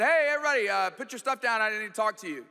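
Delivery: put-on loud call to the kids; dad calling the family to attention. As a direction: raise voice to get the kids' attention. Father calling kids in van.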